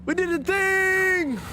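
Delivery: high, slow, drawn-out cheer; excited cheer. Real, not acted. Streamer celebrates beating the puzzle, squealing with joy. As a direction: stretch it out in triumph.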